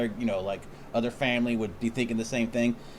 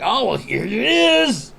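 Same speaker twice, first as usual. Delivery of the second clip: loud, high, drawn-out mock announcement; exaggerated mimicry, slow and high-pitched; mocking imitation; 10 semitones higher, 7 dB louder, much slower than usual. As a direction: stretch it out loud and high, mimicking pushy people. Mimicking intrusive sympathisers.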